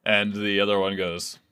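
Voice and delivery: usual speaking voice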